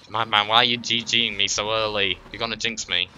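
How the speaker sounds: ordinary talk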